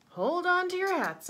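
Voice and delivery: high, loud rallying shout; rallying cry. A narrator's reading. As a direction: call everyone to action. Pockles rallies everyone.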